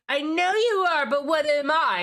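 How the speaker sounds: high, loud playground comeback; jeering schoolyard retort; childish taunt. Acted. Mocking the woke scolder's reply.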